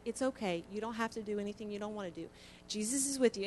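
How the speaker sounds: put-on soft, low reassurance to a sleepwalking child; calm her gently, softly; soothing husband. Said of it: "He said".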